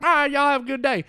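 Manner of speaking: put-on the parking guy says goodbye in a thick country accent; mock Southern accent. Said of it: "that is literally how they talk".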